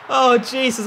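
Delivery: high, loud startled gasp of dismay; shocked cry. Real, not acted. Reacting to a gaming blunder, loud shocked cry. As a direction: blurt it out in horror.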